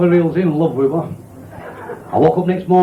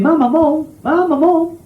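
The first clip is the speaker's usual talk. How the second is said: calling out; 11 semitones higher, slower than usual. Retold or imitated. Child calling for mother.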